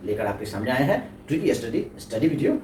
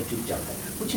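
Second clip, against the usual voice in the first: whisper; 6 semitones higher, 12 dB quieter than usual. Acted. Explaining silent letters.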